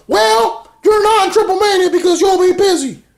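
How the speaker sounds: very high falsetto caricature; mocking Cornette impression. Retelling Cornette's lines in a wrestling recap.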